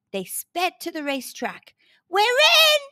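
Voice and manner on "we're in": very high, loud squeal of delight; delighted scream. A narrator's reading. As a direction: scream with joy. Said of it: "screamed a delighted Noah".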